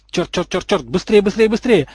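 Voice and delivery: high, rapid-fire panicky repeats; frantic urging. A narrator's reading. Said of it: "подгонял друзей Поттер-младший".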